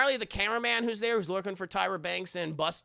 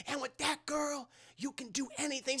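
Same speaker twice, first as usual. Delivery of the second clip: soft encouragement; 7 semitones higher, 7 dB quieter than usual. Retold or imitated. Pep talk ends softly.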